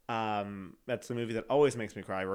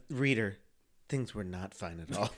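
story narrator; 5 semitones lower than usual. Acted. Addressing the reader about the bad times, narrator deadpan. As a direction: confide it to the reader in a mild deadpan. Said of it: "I love the narrator in your life".

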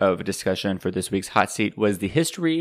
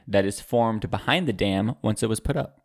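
normal talking voice

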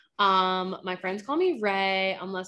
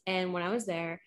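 usual speaking voice

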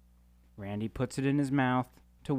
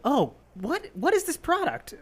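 high, wide-eyed mock voice; squeaky naive mock question; naive newbie; 3 dB louder than usual. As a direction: play the clueless new hire, pitched way up.

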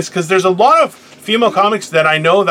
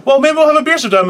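mock offer; 8 semitones higher, much faster than usual. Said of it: "And they're like".

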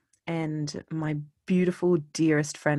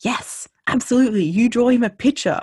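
high and much louder, thrilled; enthusiastic yes; 8 semitones higher, 8 dB louder than usual. Retold or imitated. A woman loudly urges the girl to draw the picture. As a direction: cheer her on loudly.

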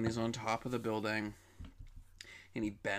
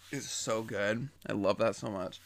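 normal talking voice

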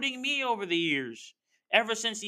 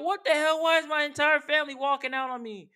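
mock disbelief; 7 semitones higher, faster than usual. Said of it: "They'll be like".

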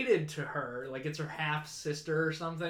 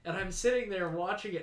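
ordinary delivery

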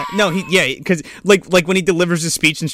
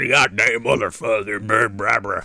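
lower gruff curse; 7 semitones lower, 3 dB quieter than usual. Acted. Acted Tex cursing.

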